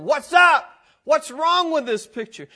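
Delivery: retelling an angry message, shouted; angry protest. Retold or imitated. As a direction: fire off the question in outrage. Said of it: "I got mad".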